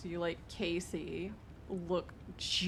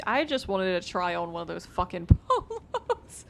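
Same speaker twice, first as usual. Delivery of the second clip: laughing; 8 dB louder than usual. Real, not acted. Laughing about trying on polo.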